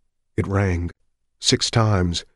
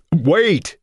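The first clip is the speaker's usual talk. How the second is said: loud, higher panicked shout; panicked yell; 10 semitones higher, 6 dB louder than usual. Acted. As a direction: yell to stop him hanging up. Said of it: "Richards yelled, panic in his voice".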